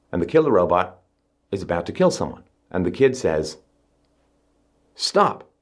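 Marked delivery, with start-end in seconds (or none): 5.0-5.5 put-on high pleading kid voice. Kid pleads with robot in movie.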